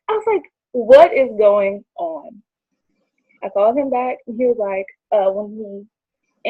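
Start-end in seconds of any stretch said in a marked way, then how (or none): 0.7-2.4 drawn-out, louder baffled question; slow, emphatic "what on earth" delivery; retold bafflement. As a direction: stretch every word in disbelief. Acting out her baffled reaction.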